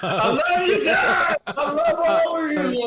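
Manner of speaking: put-on very high, loud, laughing drunken hillbilly whoop. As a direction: holler it like a tipsy hillbilly. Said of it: "I sound like a drunk hillbilly".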